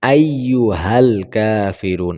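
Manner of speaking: slow, drawn-out আইয়ুহাল কাফিরুন with a clear short হাল; tajweed-correct আইয়ুহাল কাফিরুন, said slowly; correct recitation. Shown as a demonstration. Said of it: "এভাবে বলতে হবে".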